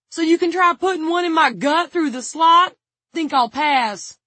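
high, loud yell, slowing at the end; sarcastic shouting. A narrator's reading. Tig refusing to fall for a trick, sarcastic yell.

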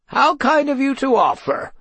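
grand declaring voice. Acted. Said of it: "declared Pewter".

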